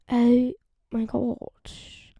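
exasperated drawl. Real, not acted. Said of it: "I can't talk".

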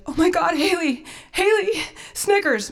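high, urgent acted panic; panicked sister. Sister phones about the dog, acted high-pitched panic. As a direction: mimic a sister calling in alarm.

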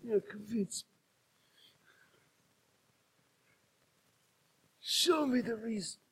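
hushed mob-boss murmur; Godfather impression. God demanding a reason for the car, Godfather voice. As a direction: do the Godfather, very soft and drawn back. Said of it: "You're like the Godfather".

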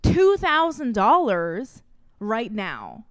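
hammering each word of the price back at the vet; retold angry confrontation.

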